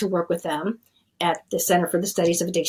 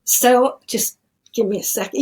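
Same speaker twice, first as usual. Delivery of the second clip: choked up; 4 semitones higher than usual. Real, not acted. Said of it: "I'm really sorry that I'm choked up".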